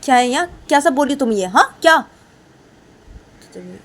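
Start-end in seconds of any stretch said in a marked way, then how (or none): 0.0-2.0 louder sharp demand; angry teacher. Acted. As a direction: demand an answer angrily. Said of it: "बोलते थे सुष्मा".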